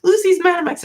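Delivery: very high falsetto cry for a husband; hammed-up high-pitched alarm; imitated frantic calling. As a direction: squeal the news in a put-on high voice. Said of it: "and then she's like".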